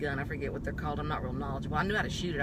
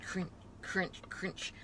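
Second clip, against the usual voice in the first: quiet unvoiced crunching sounds; crunch noise; 8 dB quieter, much slower than usual. Describing noise in the house, soft unvoiced crunches. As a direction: mimic glass crunching softly. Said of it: "I'm hearing crunch".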